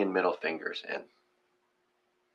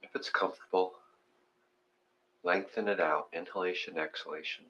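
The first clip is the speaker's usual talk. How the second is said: guided meditation; 7 dB quieter than usual. Acted. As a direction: talk them gently into their breath. Leads breathing meditation.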